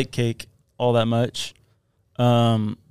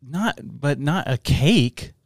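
usual speaking voice

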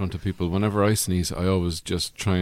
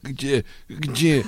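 imitating a sneeze fit; sneeze sound; 3 semitones higher than usual. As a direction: do a quick double sneeze noise.

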